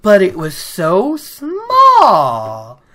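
slow, small, unsure voice; timid doubt. Retold or imitated. Doubtful girl answering her teacher, lightly voiced. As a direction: drag it out meekly. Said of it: "Egwene's like".

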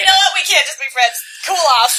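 loud comic dismissal; mock exasperation. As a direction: burst out in pretend indignation. Mock re-reading after co-host's interruption.

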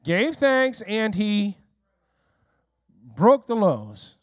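very slow, dwelling on gave thanks and broke; slow, weighty retelling; slow emphatic. Shown as a demonstration. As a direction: draw it out slowly. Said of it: "what did he do".

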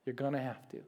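soft, low reassurance; hushed tender promise; quiet reassuring. Acted. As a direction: speak gently as God reassuring. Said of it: "God is saying".

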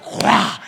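breathy unvoiced impact noise; imitated crash grunt. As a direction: make the sound of linemen colliding. Players crashing at hike.

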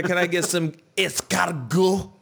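high, affected fake French "escargot"; showing off a French accent; hammed-up posh French order; fancy fake French. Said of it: "it's like".

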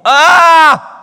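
high, loud stung cry of "ah"; pained outcry. Real, not acted. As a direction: cry out as if caught out. Reacting to the verse on slander.

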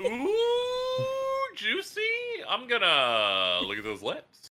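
high, teasing "ooh" then slow leer; titillated. Pretend. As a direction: play it lecherous and amused. Leering at lips in an edit.

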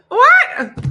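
high, loud shocked what. Real, not acted. Host reacting to the church cameraman, shocked. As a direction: blurt it out in disbelief.

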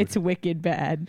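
put-on slow, broad Boston accent; exaggerated Boston accent. Wife topping husband with a worse Boston accent. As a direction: drag out wicked bad in a thick Boston accent. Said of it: "the Boston accent in my head is way worse".

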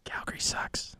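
hushed whispered aside. Real, not acted. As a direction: whisper it like a secret. Joking aside about Calgary.

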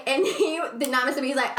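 ordinary delivery

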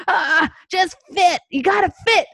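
high, strained voice pushing a peg in; mock forcing it. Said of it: "And we're like".

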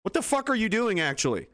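hammed-up disbelief at a baffling show; mock outraged demand. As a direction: act out the viewer who can't believe it. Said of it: "not one person was like".

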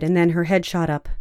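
usual speaking voice

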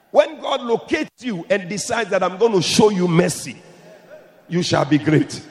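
loud rising preacher's call; full-force sermon climax; preaching at full force. Real, not acted. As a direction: drive it home to the crowd.

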